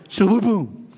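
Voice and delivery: usual speaking voice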